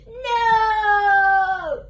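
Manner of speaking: long, loud drawn-out cry of NO; anguished shout. Acted. Book character seeing the page turned, anguished shout. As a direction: yell it out in dramatic despair.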